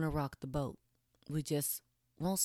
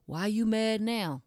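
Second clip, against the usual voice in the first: higher, louder, slow clueless question; mock clueless man, higher and louder; 7 semitones higher, 6 dB louder, slower than usual. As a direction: play the baffled boyfriend. Man asking, quoted.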